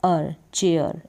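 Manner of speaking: चेयर said as che-air; teacher sounding out चेयर; chair pronunciation. Shown as a demonstration. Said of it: "C H को पढ़ा चे".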